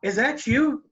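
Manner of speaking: put-on high, bright child's call from upstairs; a small daughter spotting her dad; little girl calling. Daughter calls down to her father, high childlike voice.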